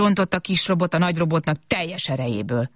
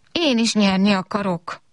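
higher-pitched whiny complaint; whining; 5 semitones higher than usual. A narrator's reading. Small robot wanting to win too, whining. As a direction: whine it like a child losing. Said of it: "Addig nyafogott".

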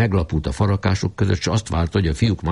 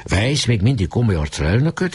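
plain reading voice

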